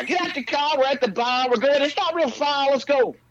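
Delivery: high, loud put-on brash voice; pushy New Yorker. New Yorker hustling friends out of the car, brash. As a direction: hustle them out to the bar.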